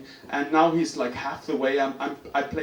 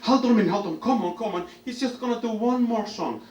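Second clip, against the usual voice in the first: raised-pitch, lightly voiced caretaker; urgent peacemaking, lightly acted; woman intervening; 7 semitones higher than usual. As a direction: plead with an angry old man. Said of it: "she comes up and".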